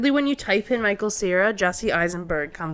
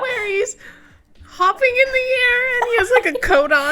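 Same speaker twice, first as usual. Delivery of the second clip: much higher voice breaking up with laughter; squealing with laughter as you describe it; real laughing fit; 6 dB louder than usual. Real, not acted. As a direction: struggle to get the words out through giggles. Describing a picture, cracking up.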